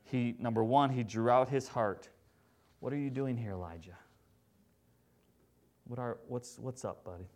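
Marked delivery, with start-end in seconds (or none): 2.8-7.3 soft, tender question; gentle whisper. Pretend. God gently asking Elijah, soft and tender. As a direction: ask it gently, like a caring friend. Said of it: "With a gentle whisper".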